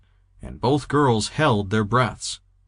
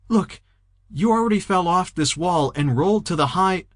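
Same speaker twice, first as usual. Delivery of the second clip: put-on scared girl's voice; 8 semitones higher than usual. Lisa pleading with Anna on wall.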